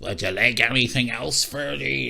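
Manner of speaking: slow, witchy imitation voice; creepy landlady. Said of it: "this is the way she fucking sounded".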